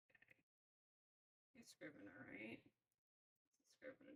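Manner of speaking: soft whisper to herself. Real, not acted. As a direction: whisper it under your breath. Whispering while setting up capture.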